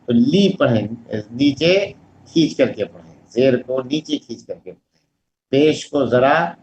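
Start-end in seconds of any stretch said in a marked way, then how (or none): none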